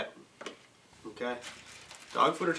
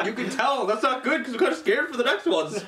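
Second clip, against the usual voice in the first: higher, laughing through it; grossed out but giggling; laughing disgust; 7 semitones higher than usual. Real, not acted. Just ate toothpaste bean.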